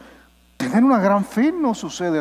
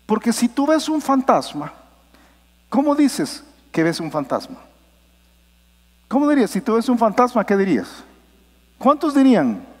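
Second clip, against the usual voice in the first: ordinary talk, nothing marked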